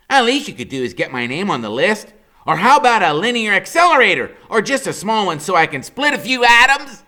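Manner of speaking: put-on high, loud boyish complaint; Freak's high whiny voice. A narrator's reading. Boy begging his mother for a big birthday present, high and whiny. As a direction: whine it out like a bratty kid.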